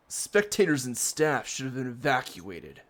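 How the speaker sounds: usual speaking voice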